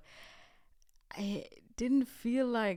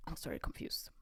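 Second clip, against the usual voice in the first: hushed whisper, much softer; 6 semitones lower, 8 dB quieter, faster than usual. Real, not acted. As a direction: drop to a breathless whisper. Aside after indignant rant.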